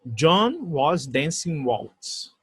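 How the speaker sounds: very slow, deliberate English; slow model sentence. Shown as a demonstration. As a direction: read it slowly for the class. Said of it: "Ah, exemplo". (frase modelo bem lenta)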